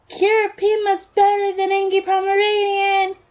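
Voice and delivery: high, sustained sing-out yell; child yelling. A narrator's reading. Son taunting Bakugo from the balcony, high child's yell. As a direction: holler it on one high pitch like a kid. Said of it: "you heard your son yell".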